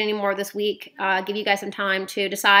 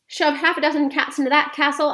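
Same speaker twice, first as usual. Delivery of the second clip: higher, louder eager plan; excited cry; 6 semitones higher, 3 dB louder than usual. A narrator's reading. Boy eagerly explains the cat plan, excited cry. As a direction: cry it out, carried away. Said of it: "I cried".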